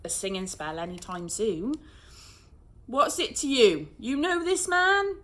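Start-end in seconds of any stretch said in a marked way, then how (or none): 2.9-5.2 loud, suspicious policeman's challenge; gruff policeman. A narrator's reading. Policeman questioning Rose, loud and suspicious. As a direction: demand an answer, higher and slower. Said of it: "the policeman asked".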